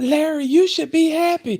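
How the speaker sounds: high, loud gushing boss voice; imitating gushing manager. Retelling to an audience, imitating his excited manager in a high voice. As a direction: mimic the manager's bubbly pitch. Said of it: "she talking about".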